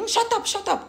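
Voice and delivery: high-pitched mock yell of the neighbour; imitated yelling. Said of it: "il a commencé aussi à crier".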